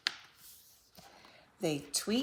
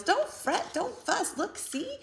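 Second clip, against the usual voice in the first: much higher, squeaky comforting mouse; squealing mouse; 12 semitones higher than usual. A narrator's reading. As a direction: squeak it out to soothe the bear. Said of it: "Mouse squeals".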